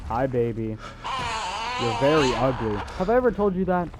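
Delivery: usual speaking voice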